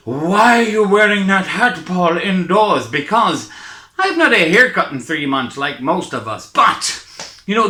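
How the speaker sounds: usual speaking voice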